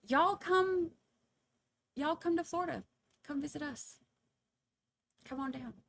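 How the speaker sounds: high, folksy come-on-down call; lightly voiced folksy welcome; Southern hostess invite. Inviting viewers to Florida.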